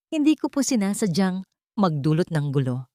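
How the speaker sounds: plain reading voice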